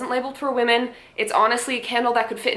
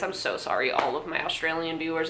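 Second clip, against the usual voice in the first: ordinary delivery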